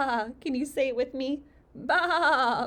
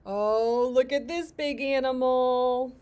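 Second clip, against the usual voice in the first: big excited reveal; 2 semitones higher, 7 dB louder than usual. Acted. Reader shows a child a big animal, loud excitement. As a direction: exclaim it big for a small listener.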